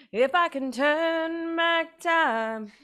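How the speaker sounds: slow, high, theatrical lament; sing-song melodramatic wish; mock dramatic. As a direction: ham up the regret.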